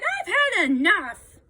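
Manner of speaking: loud, high, a laugh of despair; fed-up cry. A narrator's reading. Tired boy giving up on the climb, crying out. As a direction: blurt it out, fed up. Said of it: "he cried".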